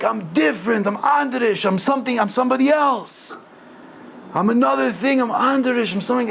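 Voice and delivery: high, insistent outcry; impassioned high-pitched protest; retold yelling. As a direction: declare it defiantly, acting out the yell. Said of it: "the Maggid was yelling this".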